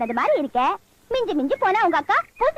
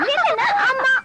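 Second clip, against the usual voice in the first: pleading shout; 8 semitones higher than usual. Acted. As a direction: beg her to stop from a distance. Calling after the running girl.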